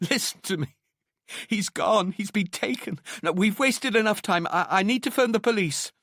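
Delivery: high-pitched distraught woman pleading; tearful near-shout, pitched high; tearful, desperate urgency. A narrator's reading. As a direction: summon your last strength and insist. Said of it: "summoning the last of her strength, the tears rolling freely".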